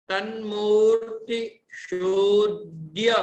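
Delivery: तन्मूर्ति शूद्य read slowly, stress on तन्मूर्ति; slow model reading; careful joined reading. Shown as a demonstration. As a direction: join तन्मूर्ति शूद्य in one slow phrase. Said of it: "यहाँ जो द्य दिख रहा है".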